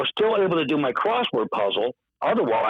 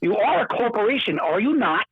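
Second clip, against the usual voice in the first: defiant insistence; 10 semitones higher than usual. Retold or imitated. Guest insisting to the CEO, high and pointed. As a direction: dig in and challenge him. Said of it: "I said corporate owned".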